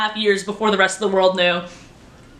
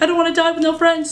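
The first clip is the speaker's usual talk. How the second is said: character line; 7 semitones higher, faster than usual. Retold or imitated. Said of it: "the voice recording was from the episode".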